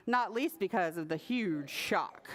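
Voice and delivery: ordinary delivery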